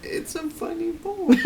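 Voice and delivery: laughing. Real, not acted. Laughing at own reading.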